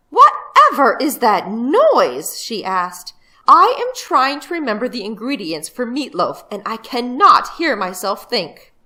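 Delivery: a flustered mother complaining about the noise; annoyed mother. Acted.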